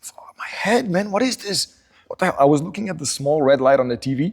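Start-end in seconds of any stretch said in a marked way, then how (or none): 0.0-2.4 higher, pained morning-after voice; hammed-up hungover self; groggy hungover groan. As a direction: moan it out like a throbbing headache. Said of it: "I was like".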